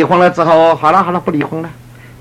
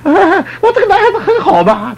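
laughing mock boast. Imitating wife's reply laughing.

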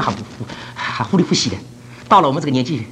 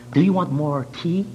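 polite English host; slower than usual. Retold or imitated. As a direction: mimic a gentleman's indirect question. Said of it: "他要茶了，他就问你的". (慢而轻的英式客气问话)

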